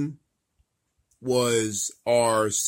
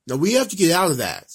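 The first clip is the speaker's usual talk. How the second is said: loud urging; 5 semitones higher, 6 dB louder, faster than usual. Real, not acted.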